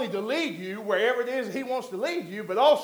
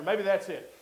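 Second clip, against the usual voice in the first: ordinary talk, nothing marked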